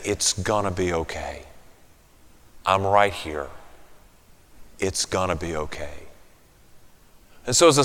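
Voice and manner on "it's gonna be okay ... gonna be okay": tender comfort. Acted. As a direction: speak as God reassuring a hurting child. God's voice comforting us.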